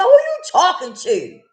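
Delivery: loud, high, drawn-out indignant challenge; frustrated. Retold or imitated. Client wanted to ask her counselor, frustrated. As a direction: stretch it out loud and high, half laughing. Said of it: "I was just frustrated".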